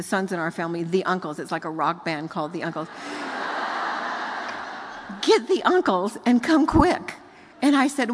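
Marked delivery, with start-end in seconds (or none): 5.2-7.2 higher, louder imitation of a small child; little girl's plea. Grandmother voicing granddaughter on phone, excited childish voice. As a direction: urge it like an excited toddler. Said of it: "And she said".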